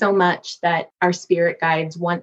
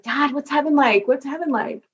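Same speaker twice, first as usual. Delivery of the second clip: higher, eager asking; eager question; 8 semitones higher than usual. Retold or imitated. Daughter asking her late dad about heaven, eager. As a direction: ask her dad like a curious kid. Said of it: "I was like".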